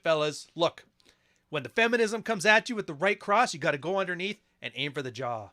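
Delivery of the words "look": ordinary talk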